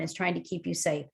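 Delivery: ordinary talk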